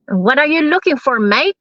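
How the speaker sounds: mock Kiwi accent, rising on "mate"; imitated Kiwi speaker. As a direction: ask it like a New Zealand local. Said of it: "sabi sa akin".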